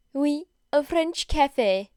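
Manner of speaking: slow, high put-on French accent; over-the-top French accent. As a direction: camp French accent, drawn out. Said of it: "I hate doing Aoyama's voice".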